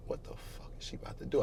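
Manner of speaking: hushed, fearful whisper; whispering a frightened thought; scared whisper. Real, not acted. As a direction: breathe it out in fear. Inner thought while scared.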